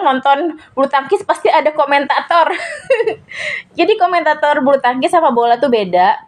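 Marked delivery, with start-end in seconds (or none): 2.5-3.7 high giggling laugh, breaking off mid-story; burst of laughter. Real, not acted. Amused at herself while recalling the badminton commentators, high laughing burst. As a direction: let a sudden high laugh escape.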